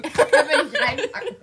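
breathless laughter; laughing so hard she can hardly speak. Real, not acted. Laughing fit after the cough.

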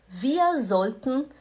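slow, clear German sentence; careful slow teaching delivery; slow demo. Shown as a demonstration. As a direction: spell it out slowly for students.

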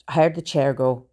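ordinary delivery

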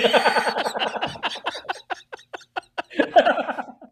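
long, high fit of laughing; real laughter. Real, not acted. Soldier's prank on the doctor lands, real laughter. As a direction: crack up helplessly after the punchline. Both crack up at prank punchline.

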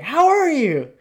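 high, loud, sing-song hello; chirpy cashier greeting. Retold or imitated. Cashier squealing hello at a groggy customer, sing-song.

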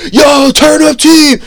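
loud, drawn-out hype shout; shouting greeting. Retold or imitated. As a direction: yell it like an excited shop guy. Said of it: "He'd see any of us and go".

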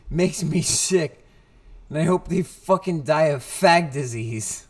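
hammed-up movie bully tirade; host reenacting Josh Peck's delivery, loud and ranting; imitating Josh Peck. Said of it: "The way that Josh Peck delivered the line".

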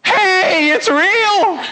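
high, excited holler across a distance; hollering back. Acted. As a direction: call it out joyfully to those far away. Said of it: "she's gonna holler back over here and say".

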